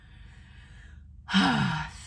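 long, breathy sigh. Real, not acted. Woman after lying on the grass, relieved sigh. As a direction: let out a big, releasing exhale.

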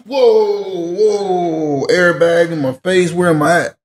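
high, slow startled whoa, waking up confused; high, slow mock bewilderment; mock dazed shock. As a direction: act out coming to with an airbag in your face. Waking dazed after crash, retold.

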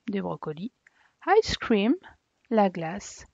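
normal talking voice

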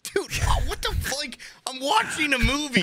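high, breathy disbelief at being interrupted; incredulous whine; mock outrage at a chatty neighbor; exasperated. Annoyed at interrupting seatmate.

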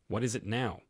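ordinary delivery, nothing marked

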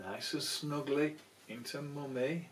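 ordinary delivery, nothing marked

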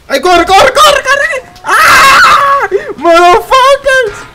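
panicked screaming while fleeing; being chased. Real, not acted. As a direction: yell in real panic, very high.

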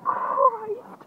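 loud start, rising into a high drawn-out cry; amazed outburst, voice leaping up; astonished exclamation. Real, not acted. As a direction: exclaim in real shock at a monster fish. Shock at size of fish.

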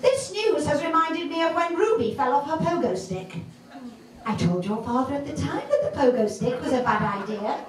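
high-pitched, mock-anxious mother voice; fretting mother. Mother texts daughter about a broken bone, fretting. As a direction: rattle on in a high, worried pitch.

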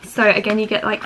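ordinary talk, nothing marked

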